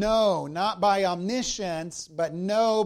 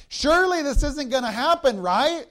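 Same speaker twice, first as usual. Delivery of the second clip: raised, louder pleading protest; a loud, higher cry of protest that this can't really happen; protesting disbelief; 7 semitones higher, 6 dB louder than usual. Retold or imitated. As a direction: push back in loud disbelief, as the congregation's voice. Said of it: "and we protest".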